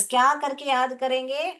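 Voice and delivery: usual speaking voice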